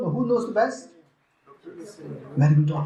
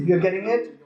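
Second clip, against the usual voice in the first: ordinary delivery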